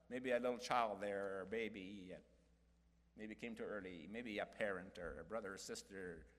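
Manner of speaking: soft, low voice for the bereaved; quiet, subdued consolation; hushed, tender. Real, not acted. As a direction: drop low and gentle, as if comforting mourners. Speaking of lost children and relatives.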